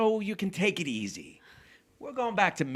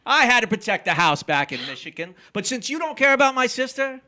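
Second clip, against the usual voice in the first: loud blustering retort; 7 semitones higher, 10 dB louder than usual. Acted. Nick shouting back at Cora over the paper, blustering loud. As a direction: shout her down, louder and higher.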